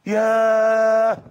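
very slow, loud, stretched aaah; long loud pained ah; long drawn ah. Acted. Blow to the young man's heart.

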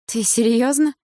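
indignant high-pitched disbelief; angry disbelief. A narrator's reading. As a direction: ask it with a touch of anger. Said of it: "выдохнула она со злостью".

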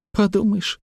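put-on high-pitched girlish scorn; dismissive snort. A narrator's reading. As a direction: brush it off like a snooty child. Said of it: "фыркнула Лекси".

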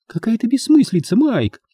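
high-pitched indignant woman. A narrator's reading. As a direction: dismiss it in a high protest. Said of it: "возмутилась Луиза".

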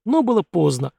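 plain reading voice

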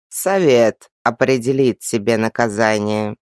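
slow, low, stern creaking voice; very old man. A narrator's reading. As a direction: pronounce judgement like an ancient tree. Old elf pronounces sentence.

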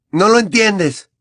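Harry roaring in frustration at Hermione; angry teen shouting back; furious shout. Acted. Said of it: "gritó Harry".

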